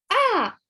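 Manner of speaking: sharp high startled cry at a cockroach; alarmed scream. A narrator's reading. Girl spotting a cockroach in her shoe, startled scream. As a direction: yelp in fright. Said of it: "she screamed in alarm".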